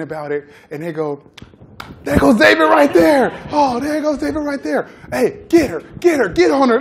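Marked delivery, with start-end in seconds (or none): 2.0-6.8 high, loud hype from the players; hyped-up shout. Retold or imitated. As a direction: egg him on with a rowdy high shout. Players hype him up at nutritionist.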